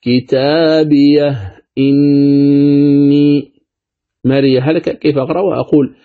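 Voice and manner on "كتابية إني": كتابية إني drawn out slowly with a clear hamza on إني; تلاوة بالتحقيق; tahqiq recitation. Shown as a demonstration. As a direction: recite slowly, stopping lightly on the ha before إني.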